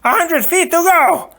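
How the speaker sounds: very high, loud scream. Acted. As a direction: scream it up at him. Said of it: "the Sicilian screamed".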